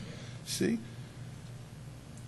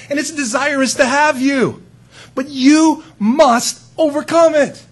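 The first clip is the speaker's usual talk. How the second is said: God warning Cain; 6 semitones higher, 5 dB louder than usual. Retold or imitated. God urging Cain to overcome sin, rising to firm. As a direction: start caring, end with a firm charge. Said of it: "what had God just said to Cain".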